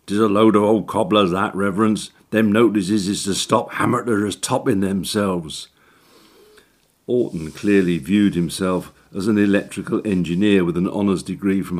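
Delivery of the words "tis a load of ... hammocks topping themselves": fast, scoffing dialect workman; cockney handyman. Acted. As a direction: brush it off like a cocky chancer. Orton dismissing the notice.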